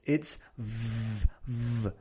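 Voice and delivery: long buzzed V on its own; isolated V sound, softly demonstrated; labiodental v. Shown as a demonstration. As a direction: hold a soft VVV, teeth on lip. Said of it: "The V in English is labio dental".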